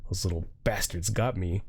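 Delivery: normal talking voice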